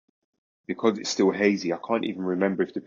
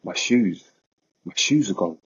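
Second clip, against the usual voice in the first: pain in voice; 3 dB louder than usual. Retold or imitated. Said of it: "with pain in my voice".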